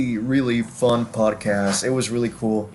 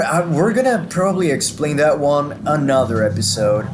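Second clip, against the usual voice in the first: ordinary talk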